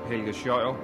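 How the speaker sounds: ordinary delivery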